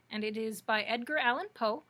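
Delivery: usual speaking voice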